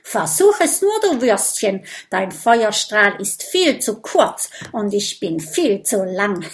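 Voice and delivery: the snake mocking the dangling dragons. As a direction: taunt them like a smug snake. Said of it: "zischt sie".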